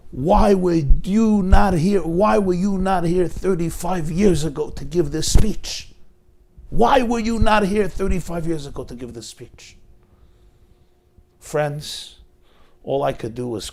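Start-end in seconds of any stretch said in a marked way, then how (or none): 0.1-9.8 a retold father's loud, heartbroken plea; anguished cry. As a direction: cry out the question as a broken father. He's sobbing, pleading father.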